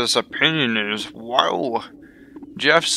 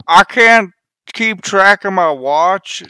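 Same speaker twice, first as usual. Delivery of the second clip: frustrated yell; 5 semitones higher, 8 dB louder than usual. Acted. Character yelling in frustration.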